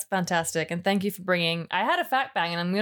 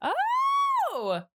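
high shriek of surprise; startled, thrilled yelp; surprised. Real, not acted. Sudden lucky guess.

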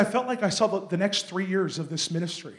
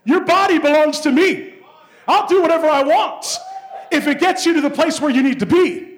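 higher, loud, mock-booming verdict; loud playful divine voice; God's reply; 9 semitones higher, 9 dB louder than usual. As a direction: play God cheerfully claiming the body. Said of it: "He said".

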